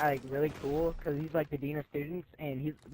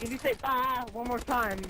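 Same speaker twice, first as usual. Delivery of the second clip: higher, bleating mock of the teacher; sheep-like teacher; 5 semitones higher than usual. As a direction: lightly bleat the teacher's warning. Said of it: "she sounded like a sheep".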